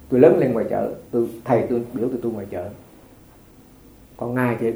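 ordinary talk

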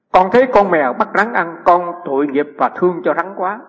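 measured read-aloud, slowing at the end. Acted. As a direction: read the written account out to the listeners. Reads the question from text.

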